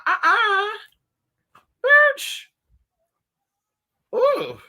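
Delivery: squealing high and loud; high exasperated squeal. Real, not acted. Fed up with the heat, a high loud squeal. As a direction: yelp it out in disbelief.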